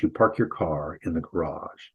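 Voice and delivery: normal talking voice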